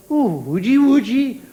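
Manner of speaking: mock baby talk, swooping pitch; silly cooing at a baby. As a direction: coo at the pram like a doting adult. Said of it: "everybody used to come and go".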